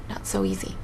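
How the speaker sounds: a hushed whisper, half to himself; whispered rueful aside. Acted. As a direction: breathe it out under his breath. Doctor muses on a dangerous task.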